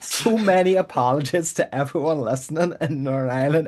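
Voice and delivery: hammed-up Belfast apology; put-on Northern Irish lilt; terrible Belfast accent. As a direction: apologise in a broad, badly faked Belfast accent. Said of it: "I am so sorry for my terrible Belfast accent".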